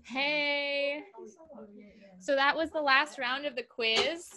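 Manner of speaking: host announcement. Real, not acted.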